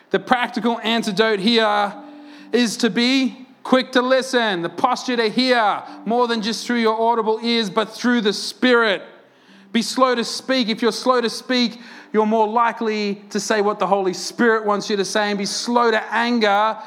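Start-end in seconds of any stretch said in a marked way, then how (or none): none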